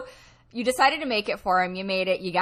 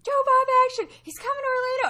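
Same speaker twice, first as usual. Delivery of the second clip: very high excited squeal; acted-out fan glee; inner cheering; 3 dB louder, faster than usual. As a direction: celebrate like an overjoyed fan. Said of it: "inside, I was like".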